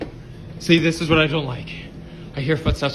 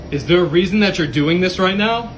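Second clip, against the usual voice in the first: raised voice calling out to the spirit; loud demanding; 6 semitones higher, 5 dB louder than usual. Real, not acted. Confronting the spirit in the store.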